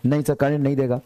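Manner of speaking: ordinary talk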